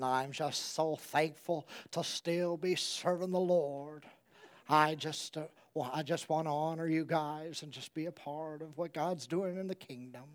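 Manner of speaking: put-on very soft, low old pastor's voice; Brother Gilbert. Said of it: "I can imitate him perfectly".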